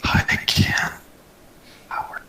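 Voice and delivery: soft unvoiced whisper. Real, not acted. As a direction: breathe the words out softly. Quiet greeting whispered.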